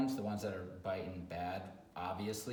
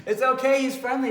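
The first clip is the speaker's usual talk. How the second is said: much higher, louder mock owner voice; 15 dB louder than usual. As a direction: parody the clueless owner excusing the dog. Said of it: "is what you get".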